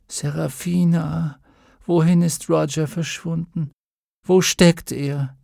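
put-on slow, drowsy girl's voice; half-awake child asking confused; sleepy child. A narrator's reading. Mädchen halb wach, verwirrt. (schläfriges Kind)

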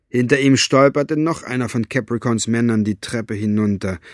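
usual speaking voice

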